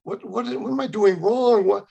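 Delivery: high, pleading cry of why; pleading with God. Retold or imitated. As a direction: beg God for an answer. Crying out to God in despair.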